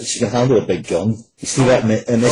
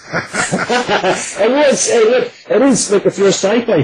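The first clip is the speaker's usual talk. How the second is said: laughing; 14 semitones higher, 6 dB louder, slower than usual. Real, not acted. Laughing reply about the violence.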